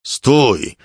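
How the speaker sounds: loud, high order to halt; repeated shouted command. A narrator's reading. Composer repeating his order to the officer, shouted command. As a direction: spit the order out forcefully. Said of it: "powtórzył kompozytor, a kropelki śliny trysnęły".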